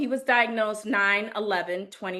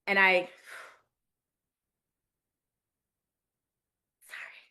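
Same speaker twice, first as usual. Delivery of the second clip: choked up; faster than usual. Real, not acted. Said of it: "Take your time".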